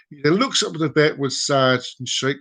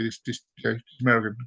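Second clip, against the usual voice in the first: usual speaking voice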